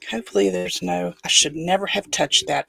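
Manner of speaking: ordinary delivery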